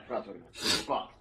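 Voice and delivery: ordinary talk, nothing marked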